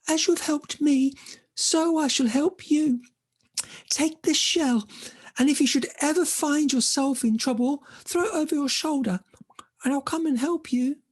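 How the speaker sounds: high, gurgling fish voice; high-pitched watery creature; gurgly voice. Acted. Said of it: "she heard a gurgly voice".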